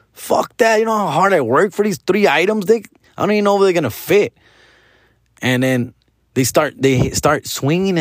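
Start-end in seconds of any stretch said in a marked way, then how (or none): 0.1-4.3 put-on defiant kid. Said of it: "And I'm like".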